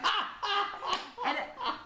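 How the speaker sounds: a high burst of rueful laughter; a short real laugh after the punchline. Real, not acted. Laughing at the CEO's reply.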